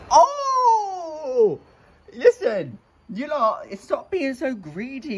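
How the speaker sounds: high, gentle scold of greedy fish. Real, not acted. Scolding her greedy fish at feeding time. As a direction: tell the fish off sweetly.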